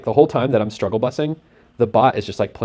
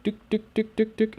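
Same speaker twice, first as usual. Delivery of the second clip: short high, soft "dook" blips; imitated blips; 11 semitones higher, 6 dB quieter than usual. Trader sounding out the chart for viewers, high soft blips. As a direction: tick out little high-pitched dooks, softly.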